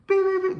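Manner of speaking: very high, loud beeps; imitated buzzer. Storyteller sounds the end of the round, piercing beeps. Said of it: "the round ends".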